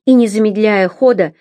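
ordinary delivery, nothing marked

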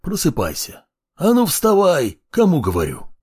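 raspy voice rising to a shout; hoarse, demanding. A narrator's reading. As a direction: croak the order, then bark it. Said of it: "просипел он ещё не прорезавшимся голосом".

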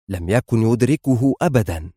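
ordinary delivery, nothing marked

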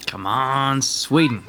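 ordinary delivery

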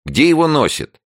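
high-pitched exasperated question. A narrator's reading. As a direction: complain about the missing host. Tanya annoyed at absent host. (раздражённо, с досадой)

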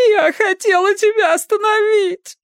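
very high, tearful wail; bawling protest; loud sobbing. A narrator's reading. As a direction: cry it out in a high, strained girl's voice. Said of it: "девица зарыдала в голос".

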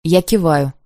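plain reading voice

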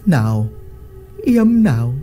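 leering chief. Acted. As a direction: sweet-talk her like a lecher. Lão Lý grabbing her.

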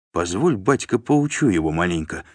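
usual speaking voice

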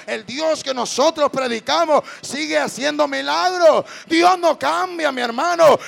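loud, fervent preacher's cry; full-force preaching. Acted. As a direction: preach it out to the whole crowd. (predicando a todo pulmón)

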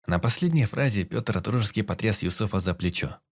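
ordinary delivery

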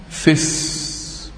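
"فس" with short i and a long held hiss; soft fisss, stretching the s of "فس"; linked, ya dropped. Shown as a demonstration. Teacher showing the dropped ya, "فس" as short fi into held s. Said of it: "حذفنا الياء".

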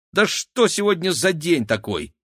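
high-pitched exasperated protest; indignant. A narrator's reading. Girl complains about her day after being locked in, indignant. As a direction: throw up a high, exasperated question. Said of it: "возмутилась Кира".

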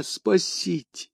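затихающая мольба; plea fading out. A narrator's reading. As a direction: let the last cry for help die away. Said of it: "возопил он".

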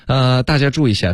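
ordinary delivery

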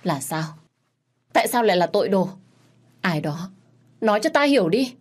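a shocked mother demands answers; shocked outcry. Acted. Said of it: "Thái Phi nghe xong bị sốc". (mẹ bàng hoàng, kêu lên cao và to)

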